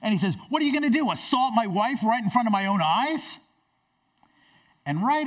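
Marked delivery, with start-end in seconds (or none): 0.5-3.4 high, loud, outraged husband; really angry. Acted. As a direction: explode as a furious king. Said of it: "he's really angry now".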